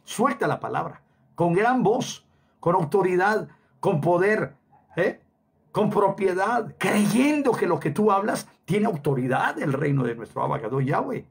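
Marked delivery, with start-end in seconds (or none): 1.3-8.1 preaching emphasis. Real, not acted. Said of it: "preaching climax".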